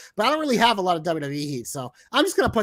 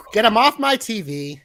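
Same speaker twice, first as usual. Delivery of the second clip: higher, louder take of the catchphrase; 3 semitones higher, 6 dB louder than usual. Pretend. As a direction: belt it out like a wrestler's catchphrase. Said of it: "to change it up a little".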